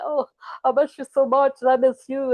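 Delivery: loud, high hammed-up lovesick outburst; mock gushing. Reader acting exes bumping into each other, mock gushing. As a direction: gush it like a reunited lover. Acted reunion of missing lovers.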